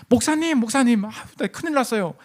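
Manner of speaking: high-pitched, acted-out fretting to the pastor; mock panicked plea. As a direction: play someone rushing in with trouble. Upset new believer runs to pastor.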